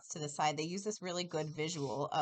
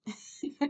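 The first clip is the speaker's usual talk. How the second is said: breathy laugh; 9 semitones higher than usual. Real, not acted. Reacts to "you're weird" joke.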